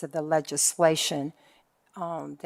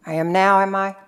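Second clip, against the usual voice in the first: much louder, projecting into the room; speaking up; 3 semitones higher, 11 dB louder, faster than usual. Real, not acted. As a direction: boost the voice so the back of the room hears. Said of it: "I'm not talking loud enough".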